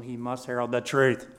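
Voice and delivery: firm pulpit stress on "must"; emphasis on must. Shown as a demonstration. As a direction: punch "must" and keep the force rising through "truth". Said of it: "I'm putting the emphasis on".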